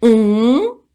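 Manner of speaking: "um" as French on, loud nasal vowel. Shown as a demonstration. Teacher modelling the pronoun on, nasal vowel. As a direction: hold "um" between o and u, through the nose. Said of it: "que é um som nasal".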